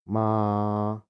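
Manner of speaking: "ma" held level and even; first tone on "ma", steady pitch; flat tone. Shown as a demonstration. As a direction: Mandarin first tone. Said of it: "ada nada datar".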